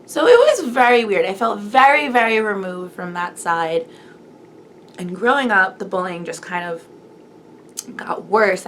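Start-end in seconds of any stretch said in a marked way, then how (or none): none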